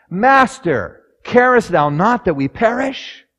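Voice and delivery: high, loud, anguished plea; despairing cry. Acted. Terrified disciples waking Jesus, high loud cry. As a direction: shout it at the sleeping master.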